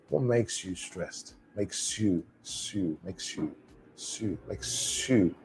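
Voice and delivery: soft, slow drill running "makes you" into "make-sue"; linked pronunciation. Shown as a demonstration. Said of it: "let's think about that pronunciation".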